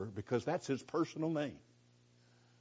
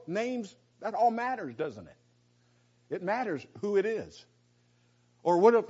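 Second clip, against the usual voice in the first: normal talking voice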